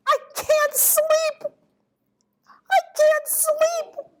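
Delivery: slow, very high owl wail. A narrator's reading. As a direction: draw it out high like a distressed owl. Sleepless owl wails in story.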